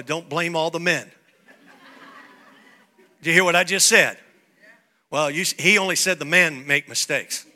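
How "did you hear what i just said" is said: ordinary talk, nothing marked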